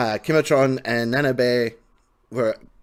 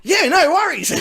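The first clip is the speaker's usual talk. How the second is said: mock cheerful salesman; 13 semitones higher, 5 dB louder than usual. Imitating salesman's eager reply.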